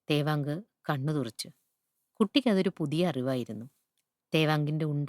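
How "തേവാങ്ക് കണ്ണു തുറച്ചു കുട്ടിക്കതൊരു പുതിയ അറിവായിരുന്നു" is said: ordinary delivery, nothing marked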